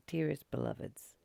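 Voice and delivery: ordinary talk, nothing marked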